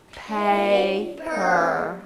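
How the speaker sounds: "Paper" drawn out as pay, per; split into syllables. Shown as a demonstration. Teacher leading the class in the new word, "Paper" stretched out. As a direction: stretch "Paper" over two slow beats. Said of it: "let's say that together".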